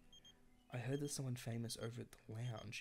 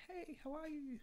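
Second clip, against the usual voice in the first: high, breezy mock-casual hello; suave greeting. Gamer voicing her Sim greeting an ex, mock suave. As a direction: play it cool but much higher than your own voice. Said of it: "We should be like".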